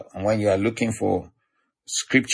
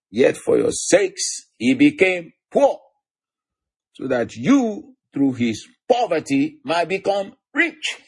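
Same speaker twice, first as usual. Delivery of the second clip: slow, high, ringing scripture declamation; emphatic scripture reading; 8 semitones higher than usual. Acted. Preacher reading the verse on poverty and riches to listeners, emphatic. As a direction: proclaim each phrase, pitching the key words high.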